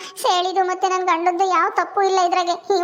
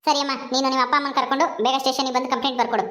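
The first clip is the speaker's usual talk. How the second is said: lower, brisk police officer voice; an officer giving quick orders; stern policewoman; 6 semitones lower, faster than usual. Acted. Policewoman role held. (ಗಡುಸಾದ police ಅಧಿಕಾರಿಯ ಧ್ವನಿ)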